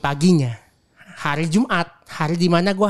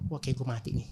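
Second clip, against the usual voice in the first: hushed, much softer inner thought; fearful thought; 6 semitones lower, 13 dB quieter than usual. Retold or imitated. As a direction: mutter the dread to yourself. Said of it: "gua udah mikir".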